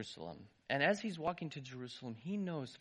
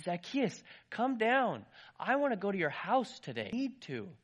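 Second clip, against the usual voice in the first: calling up; 6 semitones higher, 6 dB louder than usual. Acted. Jesus calling up to Zacchaeus in the tree, loud call.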